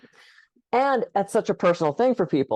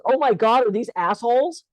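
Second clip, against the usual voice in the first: higher, louder, wide-eyed outburst; shocked kid; 7 semitones higher, 3 dB louder than usual. Acted. Kid at school realizing the flavor, shocked and loud. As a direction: blurt it out like a shocked child. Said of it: "spits the lifesaver out of his mouth and goes".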